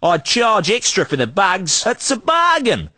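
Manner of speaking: hammed-up Aussie salesman twang; broad Australian accent. Street trader hawking junk in a broad Australian accent. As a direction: lay on the outback Australian accent thick. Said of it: "we only talk like that".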